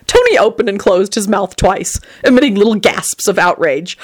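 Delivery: ordinary delivery, nothing marked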